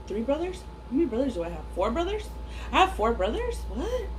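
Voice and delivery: surprised. Real, not acted. Surprised at own brother count.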